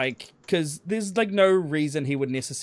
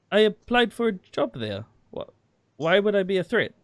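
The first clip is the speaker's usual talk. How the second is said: put-on light broken German accent, higher; a hint of German in broken English; 7 semitones higher, 3 dB louder than usual. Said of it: "Again in the broken German".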